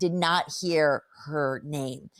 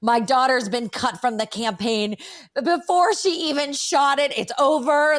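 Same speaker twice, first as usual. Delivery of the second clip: mock devastation, rising higher and louder; melodramatic dismay; 6 semitones higher, 3 dB louder than usual. Mother learning her daughter was cut, melodramatic dismay. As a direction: build it into an overblown catastrophe.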